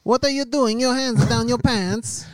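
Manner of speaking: put-on high, loud Borat accent; Borat impression. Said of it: "I'm running as Borat".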